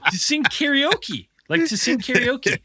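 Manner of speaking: high, timid reply; meek answer. Retold or imitated. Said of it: "we're like".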